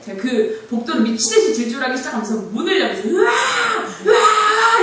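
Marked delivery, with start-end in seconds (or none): 3.1-4.8 mock scream of panic, high and loud; frantic shriek while running the hall; panicked scream. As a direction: let out a wild panicked yell. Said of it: "이러면서 문을 막 열고".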